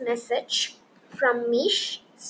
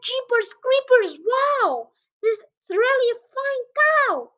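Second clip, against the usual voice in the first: higher, louder cheering crowd; excited shout; 11 semitones higher, 5 dB louder than usual. Acted. Crowd cheering the flying cow from below, higher excited shout. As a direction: shout it up in delight. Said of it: "They shouted".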